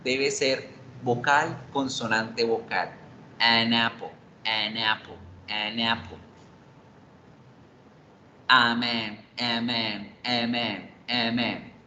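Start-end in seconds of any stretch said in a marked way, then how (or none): 3.4-6.2 "An apple" linked smoothly, lower pitch, n into apple; an apple fluido. Shown as a demonstration. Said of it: "un sonido estéticamente bien escuchado". 8.5-11.7 "Amén" as one linked word, a straight into man; a man fluido. Shown as a demonstration. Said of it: "Todo fluido".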